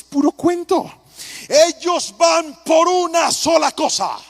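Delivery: slow, loud and high, hammering each word; preacher hammers the point to the church, slow emphatic shout. Acted.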